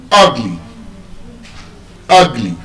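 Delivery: "ugly" as UG-ly, falling; first-syllable stress. Shown as a demonstration. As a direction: hit the first syllable of "ugly" and drop the second. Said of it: "be stressed on".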